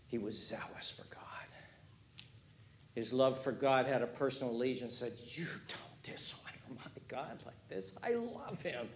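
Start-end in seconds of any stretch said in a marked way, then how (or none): 0.1-1.2 hushed whisper. Real, not acted. Preacher whispers. 5.3-8.9 whispered, intense resolve; a near-whisper of fierce loyalty; hushed fierce vow. Retold or imitated. Phineas voiced, hushed.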